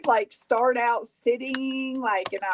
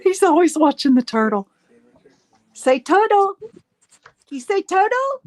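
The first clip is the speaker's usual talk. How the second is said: high louder coaxing; 9 semitones higher, 6 dB louder than usual. Real, not acted. Coaxing baby to say turtle.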